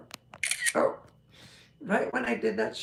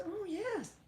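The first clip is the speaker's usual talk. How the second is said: cowgirl lady voice; 13 semitones higher, faster than usual. Retold or imitated. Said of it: "She says".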